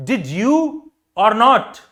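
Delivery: "Did you" swooping up high, then a loud "or not" dropping down; high-low pitch demo. Shown as a demonstration. Teacher showing students high and low pitch, exaggerated intonation. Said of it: "तो मैंने high pitch लो किया".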